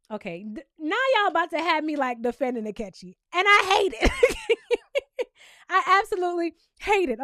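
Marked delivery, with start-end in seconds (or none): 3.3-7.2 loud, high, laughing through it; cracking up at herself; laughing protest. Real, not acted. Vlogger laughing at defending Akechi, loud laughing protest.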